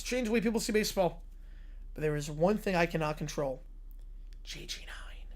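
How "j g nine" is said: ominous whisper. Acted. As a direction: breathe the name in a hush. Villain whispers the name.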